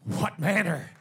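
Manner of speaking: firm, emphatic "What manner", then let it drop. Shown as a demonstration. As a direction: punch out "What manner" as an exclamation. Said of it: "It was said emphatically".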